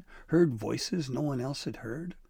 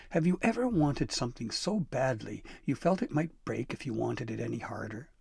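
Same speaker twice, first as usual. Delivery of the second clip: usual speaking voice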